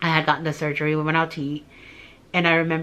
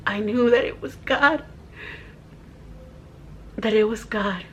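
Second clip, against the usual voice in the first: choked-up conviction with a long halting pause; emotional, tearful; 3 dB quieter than usual. Real, not acted. Woman testifying that it was God, tearful. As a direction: testify through real tears. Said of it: "I don't wanna get emotional".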